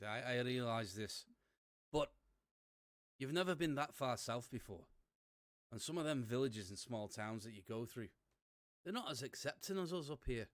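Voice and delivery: a deliberately awful Scottish brogue; bad Scottish accent. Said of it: "in my worst Scottish accent ever".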